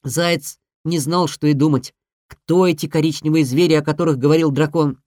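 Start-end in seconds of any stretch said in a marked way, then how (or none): none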